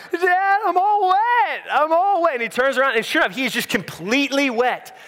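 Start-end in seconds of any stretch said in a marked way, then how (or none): 0.1-2.3 put-on high, slow whining kid voice, much higher; whiny three-year-old. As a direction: whine it like a soaked toddler. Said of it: "He's like".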